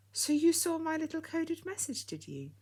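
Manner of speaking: put-on old lady delighted Freddy saw her message, smiling; pleased, smiling. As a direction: beam with pleasure as you ask. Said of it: "smiling with pleasure".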